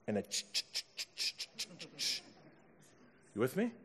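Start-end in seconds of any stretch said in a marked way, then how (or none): 0.2-2.2 quiet chugging guitar noise; imitated electric guitar. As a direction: chug softly like an electric guitar. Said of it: "with, like, electric guitar".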